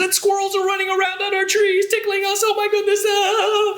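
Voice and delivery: very high, playful squeaky voice; high-pitched, quick kiddie-show voice; tickled squeal. Pretend. Pretends squirrels are tickling the trees.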